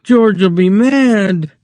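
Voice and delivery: high, slow pleading; Lenny begging her not to scream. A narrator's reading. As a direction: plead with her, scared of George. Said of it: "he begged".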